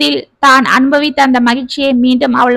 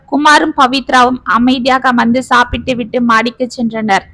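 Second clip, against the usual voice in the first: usual speaking voice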